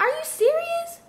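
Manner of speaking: can't believe what she's hearing; incredulous. Retold or imitated. Reacting to low pay.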